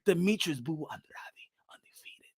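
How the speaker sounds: 속삭이는 아나운서 톤; dramatic whisper. Acted. Dramatic hushed fight intro.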